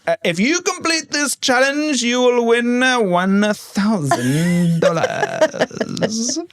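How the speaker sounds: high, then drawn-out slow host announcement; milk the tiny prize like a grand reveal; hammed-up game show host; mock host. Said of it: "they're like".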